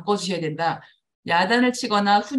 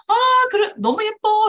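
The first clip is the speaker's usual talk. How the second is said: very high, loud doting praise; 13 semitones higher, 6 dB louder than usual. Shown as a demonstration. Mother praising her cute child, very high loud tone. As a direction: gush over a cute child. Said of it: "하이톤으로".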